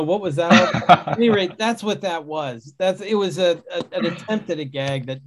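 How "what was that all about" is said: laughing mock bewilderment. Imagined puzzled reaction, laughing.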